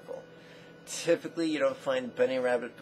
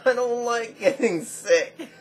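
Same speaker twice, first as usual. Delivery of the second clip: loud, high-pitched exclamation; emphatic outburst; 10 semitones higher, 11 dB louder than usual. Real, not acted. Fitness host admitting he hates illness, in a loud high outburst. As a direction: blurt it out loud and high, half laughing. Exclaims his dislike of being sick.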